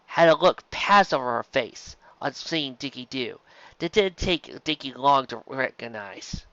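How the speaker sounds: ordinary delivery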